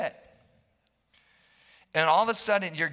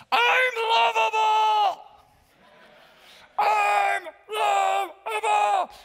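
angry outburst; 11 semitones higher, 3 dB louder than usual. Acted. Pastor acting out love-starved people, angry shouting building to a wail. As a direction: act out a love-starved person's mounting, hammed-up fury. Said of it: "they're getting a little bit angry".

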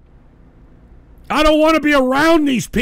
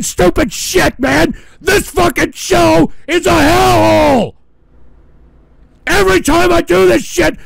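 louder and higher, shouted tirade; explode, you've had enough for real; furious rant; 5 semitones higher, 5 dB louder than usual. Real, not acted. Streamer ranting that the show is a hellhole, furious yelling.

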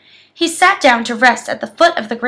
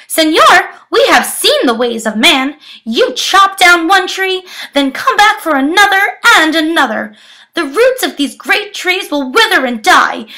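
higher, louder excited monkey voices; chattering monkeys; 5 semitones higher, 4 dB louder than usual. Acted. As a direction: warn like agitated monkeys. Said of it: "They chattered".

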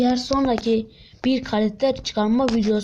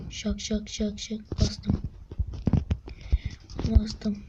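tense whisper; 8 dB quieter, slower than usual. Real, not acted. Tense whisper while pressing.

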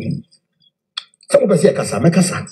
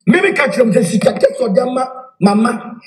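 normal talking voice

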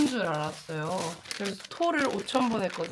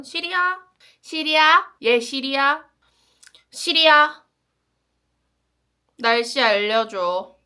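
loud repeated calls to Siri; talking to voice assistant; 5 semitones higher, 8 dB louder, slower than usual. Real, not acted. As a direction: raise the voice to wake the phone. Calling Siri for the weather.

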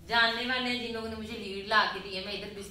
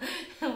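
short laugh; 9 semitones higher than usual. Real, not acted. Laughs at calling herself innocent.

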